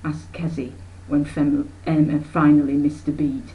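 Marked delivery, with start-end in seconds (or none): none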